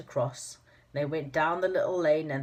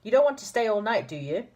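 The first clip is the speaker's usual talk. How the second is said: teasing Moonface voice; 6 semitones higher, 5 dB louder than usual. Acted.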